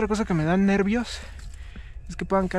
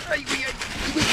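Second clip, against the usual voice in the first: panicked gasp; 7 semitones higher, faster than usual. Real, not acted. Slipping while climbing.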